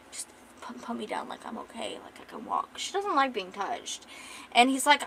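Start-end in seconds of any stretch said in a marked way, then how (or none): 0.1-2.8 faint, whispered, feeble objection; weak protest. Acted. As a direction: protest with barely any strength. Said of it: "she's really weak when she says it".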